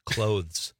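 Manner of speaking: "Clothes" with a voiced TH before the Z; careful, proper "Clothes" with an audible TH; TH sounded in clothes. Shown as a demonstration. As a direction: pronounce the TH in "Clothes", don't drop it. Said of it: "I use the T H in that word".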